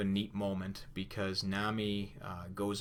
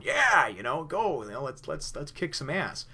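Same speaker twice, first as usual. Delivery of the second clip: high, loud, pumped-up call to fight; rally everyone like a fired-up kid; eager Luffy; 7 semitones higher, 6 dB louder than usual. Retold or imitated.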